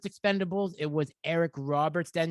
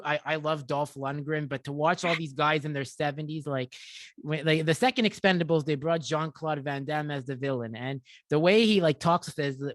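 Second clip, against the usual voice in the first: ordinary talk, nothing marked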